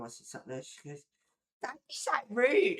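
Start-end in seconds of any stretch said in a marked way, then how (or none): 1.6-2.8 high, loud mocking imitation; offended woman's retort. Streamer imitating the woman she turned away, high indignant retort. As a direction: mimic her indignation in a raised pitch.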